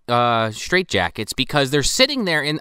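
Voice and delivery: ordinary talk, nothing marked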